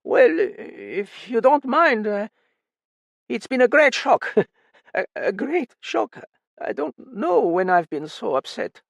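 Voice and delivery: put-on hesitant, shaken old father; hesitant old man. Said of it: "the old man said hesitantly".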